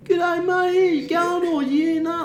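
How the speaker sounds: very high, slow, drawn-out stoner voice; lazy surfer greeting; Aussie stoner. Retold or imitated. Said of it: "his voice".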